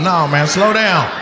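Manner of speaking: ordinary talk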